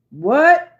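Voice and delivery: sharp high surprised "What?"; startled outcry. Real, not acted. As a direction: react in disbelief, loud and high. Surprised reaction to message.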